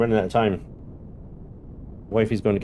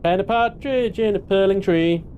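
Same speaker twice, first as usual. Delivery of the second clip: a broad West Country lilt, pitched up; West Country accent; 8 semitones higher than usual. Pretend. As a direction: hams up a rural West Country voice. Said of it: "does that accent a little too well".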